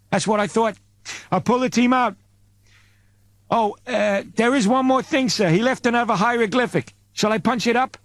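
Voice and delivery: a worried subordinate reporting; Apton Sticks voice. Acted.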